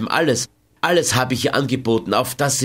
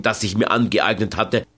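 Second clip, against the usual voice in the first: plain reading voice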